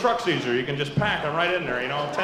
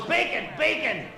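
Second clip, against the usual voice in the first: lightly higher, slow goofy uncle; uncle's voice; 7 semitones higher than usual. Retold or imitated. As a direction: drawl out the request like the big-headed uncle. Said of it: "and he goes".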